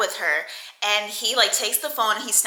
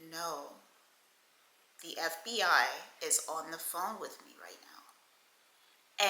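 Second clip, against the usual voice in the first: hushed, breathy-whispered quote; whispered retelling; 4 semitones lower, 14 dB quieter than usual. Retold or imitated. Woman tells him who is on the phone, whispered. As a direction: whisper it as a secret report.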